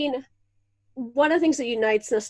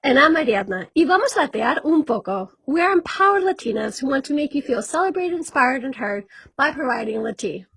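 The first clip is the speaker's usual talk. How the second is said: podcast intro; 3 dB louder than usual. Acted. Hosts introducing their podcast, bright promo read. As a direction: sell the show like a radio promo.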